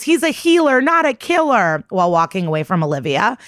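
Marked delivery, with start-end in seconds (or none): 0.0-1.8 imitating someone yelling in protest; mock yell. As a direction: cry it out in a raised pitch, without a full shout. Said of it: "She yells".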